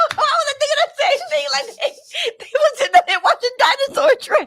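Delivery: laughing through the words; high, loud laughing. Real, not acted. Both laughing hard.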